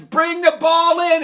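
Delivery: loud high call; coach's call. Retold or imitated. Other team's coach calling his player, loud. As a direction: call it across the field.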